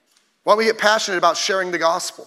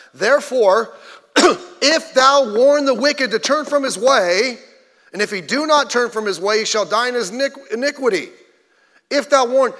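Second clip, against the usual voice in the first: solemn Bible reading, higher and louder; scripture reading; 5 semitones higher, 5 dB louder than usual. Acted. Reads scripture aloud.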